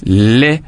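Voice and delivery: "lé" long and rising; separated syllable. Shown as a demonstration. Teacher numbering the parts of école, last one drawn out. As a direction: sound the end of école as a full lé. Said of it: "la troisième syllabe".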